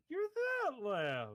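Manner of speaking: slow, rising to very high on that Lev; hammed-up clown delight; clown recognizing. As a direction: draw out the recognition, then squeal.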